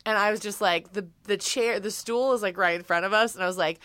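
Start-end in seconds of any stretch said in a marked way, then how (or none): none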